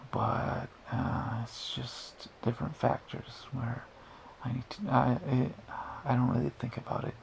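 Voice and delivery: soft breathy whisper throughout; ASMR whisper. Shown as a demonstration. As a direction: keep whispering into the mic. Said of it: "I'm gonna have to talk normally again".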